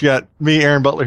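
ordinary talk

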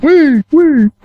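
co-host faking an echo effect on the host's last word, high and loud; mock echo. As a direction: echo the word like an announcer's reverb. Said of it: "Add some reverb in there".

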